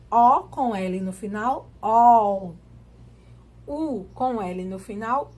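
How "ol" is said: "ol" said loud as open O gliding into U; L sounded as U. Shown as a demonstration. Teacher demonstrating O with L at the end, loud, L sounded as U. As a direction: belt out "ol" like "óu". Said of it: "um som de U".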